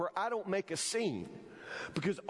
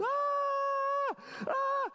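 very high, sustained mock scream; imitated lady's scream. Preacher imitating the lady who lost her wig, high scream. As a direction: let out a long high shriek, imitating her. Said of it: "I hear this lady scream".

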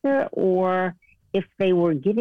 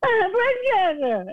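high-pitched tearful wail, hammed up; exaggerated crying voice; mock wailing. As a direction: wail it like a sobbing caller. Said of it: "That's what it was like".

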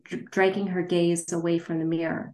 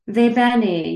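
loud Italian "va bene"; Italian phrase; 5 semitones higher, 10 dB louder than usual. Acted.

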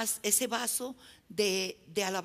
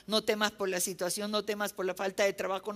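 normal talking voice

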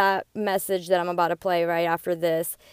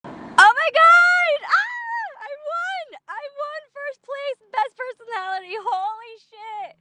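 high, shrieked joy at a real win; ecstatic screaming; 7 dB louder than usual. Real, not acted. Winner reacting to first place in best personality, ecstatic screaming. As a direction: scream in elated disbelief, stretching the words out.

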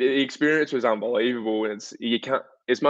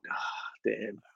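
breathy deflated whisper; crushed whisper to himself; whispered disappointment; 12 dB quieter than usual. Retold or imitated. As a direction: whisper it like your heart just sank.

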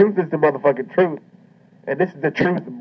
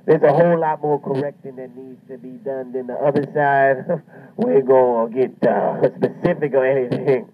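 normal talking voice

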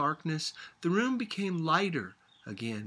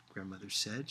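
ordinary delivery

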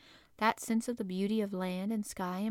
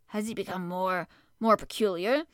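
anxious; 5 dB louder than usual. Acted. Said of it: "knitting his brows anxiously".

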